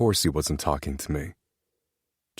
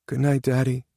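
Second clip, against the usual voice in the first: put-on little boy's goodnight; 8 semitones higher than usual. Child says goodnight from bed.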